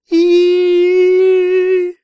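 put-on very high, loud teenage squeal. A narrator's reading. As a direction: squeal shrilly like a boy. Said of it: "тонким и ломким подростковым голосом запищал".

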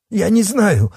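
high, squeaky protest; panicked denial. A narrator's reading. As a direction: blurt it out high in fright. Coward frantically denies knowing.

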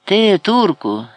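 high, loud, drawn-out taunt; mocking call, no real laugh; scornful, amused. A narrator's reading. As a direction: throw it out as a slow jeer. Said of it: "засміявся Ібрагім".

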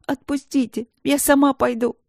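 high, tearful plea; tearful pleading. A narrator's reading. Princess pleads through tears, high. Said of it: "всхлипывала она".

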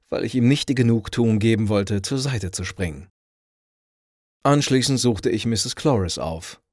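ordinary delivery, nothing marked

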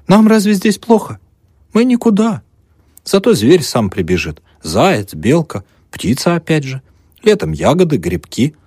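put-on high, loud, bustling old peasant woman; fussing old woman. Old mother bustling over the student's bed. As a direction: flutter about the guest, all eager to please.

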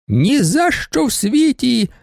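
high, slow shriek of refusal. A narrator's reading. Baroness shrieks at the judge, refusing. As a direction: shriek it out, drawn out. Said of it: "вереснула баронеса".

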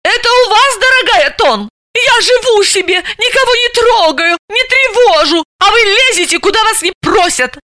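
high, indignant outburst; fly off the handle at her rival; истерично; shrill quarrel. Acted. Hysterical woman quarrelling.

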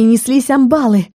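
ordinary delivery, nothing marked

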